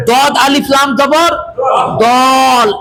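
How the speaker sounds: teacher spelling then sounding out; slow spelled reading. Shown as a demonstration. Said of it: "একবার উচ্চারণ হলো কিনা".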